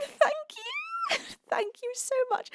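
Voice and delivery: high, teary laugh-crying thank-you; overcome, thanks breaking into tearful laughter; laughing through tears. Real, not acted. Overwhelmed by a fan's kind message.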